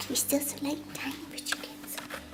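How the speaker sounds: fast hushed whisper to a newborn. Real, not acted. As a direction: whisper so you won't startle him. Whispers to the baby.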